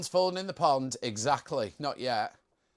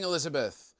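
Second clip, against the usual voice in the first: usual speaking voice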